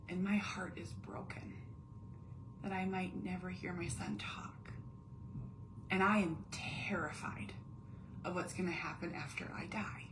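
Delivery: unvoiced hushed confession; softly whispered private terror. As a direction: whisper it like a secret fear. Voiced inner feelings, whispered.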